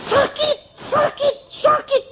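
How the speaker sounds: high wailing cries of pain; mock agonised wail; screaming in pain. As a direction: howl it like burning in the light. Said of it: "dia teriak-teriak bagaimana?".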